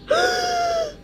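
sharp, high gasp with a cry. Acted. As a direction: gasp out in shock, almost crying. Character gasps in alarm.

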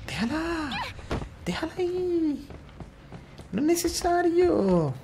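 frantic high cries at the screen; high yell. Real, not acted. Gamer yelling at a character to leave the girl, frantic.

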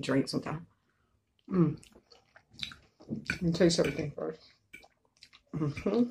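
chewing while talking; speaking between bites; mouth full of food. Real, not acted. Seen 0: Bites from fork at 4.4–4.9s; chewing between bites throughout.

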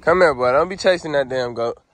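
high, loud commands to stop chasing; loud high scolding of a dog. Real, not acted. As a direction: tell the dog off, then call it back. Calling the dog off the goat.

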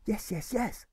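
put-on keen young boy, high. Alfred answers the grocer, keen and boyish. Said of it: "in a voice which sounds keen".

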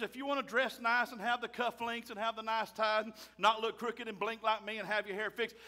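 ordinary delivery

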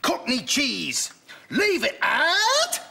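slow, high slogan read, rising at the end; slogan try-out. Acted. Account director trying out Billy's slogan, slow high questioning pitch. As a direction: deliver the slogan slowly, lifting into a long high question.

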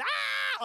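high, loud cry of joy; mock cheer scream. Crowd seeing wife running.

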